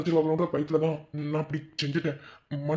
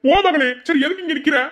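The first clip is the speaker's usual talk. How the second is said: loud, high-pitched scolding uncle; 11 semitones higher, 8 dB louder than usual. Acted. Uncle loudly scolding his niece to go eat. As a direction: bark at her to go eat.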